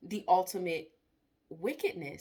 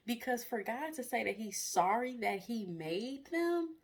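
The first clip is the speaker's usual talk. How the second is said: ordinary talk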